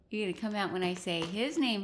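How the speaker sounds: ordinary delivery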